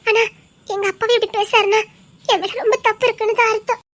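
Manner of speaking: usual speaking voice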